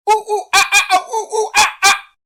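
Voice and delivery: very high, loud monkey whoops; wild, excited monkey hooting; monkey call. A narrator's reading. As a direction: screech ooh-ooh, ah-ah from the treetops. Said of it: "a call from high in the trees".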